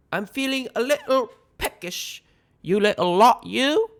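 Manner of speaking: very high, hammed-up British accent; mock-British teasing in a high voice; bad British accent. A narrator's reading. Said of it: "in her bad British accent".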